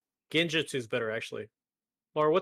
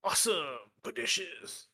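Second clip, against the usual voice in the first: slow, drawn-out dude voice; Ninja Turtle voice; 6 semitones higher, 4 dB louder, slower than usual. Pretend. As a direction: stretch each word like a cartoon turtle. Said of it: "the surfer guy voice?".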